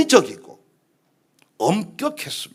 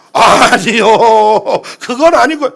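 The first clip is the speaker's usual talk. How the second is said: backing down; 2 semitones higher, 12 dB louder than usual. Acted. Scolded pastor answers the Holy Spirit, whiny backing down. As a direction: protest sheepishly to the one scolding.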